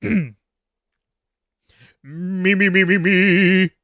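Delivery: slow vocal warm-up on me; warming up the voice slowly. Acted. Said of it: "a less gravelly voice".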